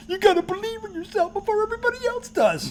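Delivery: high, put-on sing-out voice; mocking motivational line. Host mocking Kimberly about the coach's slogan, high mocking voice. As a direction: parody a pep slogan way up high.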